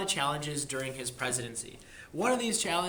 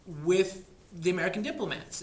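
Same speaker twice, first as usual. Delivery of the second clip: ordinary delivery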